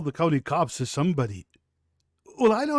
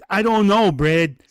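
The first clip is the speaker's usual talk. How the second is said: ordinary talk, nothing marked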